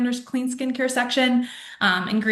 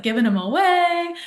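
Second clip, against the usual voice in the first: eager giveaway; 9 semitones higher, 5 dB louder than usual. Retold or imitated. Acting out eager promotion of products.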